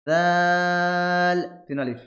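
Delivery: দাল drawn out slowly over three alif; three alif stretch. Shown as a demonstration. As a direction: give দাল its full long pull.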